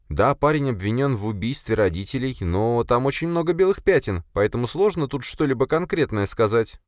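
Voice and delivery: high, even female-character voice; Sofia, doubtful. Acted. Sofia explains gaps in case to boss, doubtful. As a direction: reason it out in a high voice.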